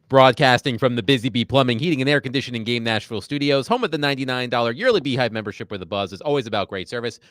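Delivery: punchy station ID read; big booming radio plug; radio announcer. Acted.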